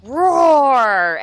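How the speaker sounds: big booming monster roar; huge fiery dragon roar. Retold or imitated. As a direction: roar it out like a giant beast. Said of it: "Sully comes on".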